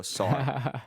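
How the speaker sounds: "Sar" begun with a plain S sound instead of SH; mispronounced surname. Retold or imitated. Graduate quotes the teacher botching their surname, mispronounced "Sar" with plain S. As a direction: say "Sar" with S, not SH, imitating the mistake. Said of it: "see S H A and you go with S".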